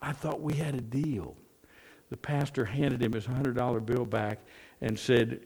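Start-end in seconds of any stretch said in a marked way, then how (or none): 0.0-1.4 hushed conspiratorial whisper. Retold or imitated. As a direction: whisper it aside, half voiced. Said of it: "he whispered".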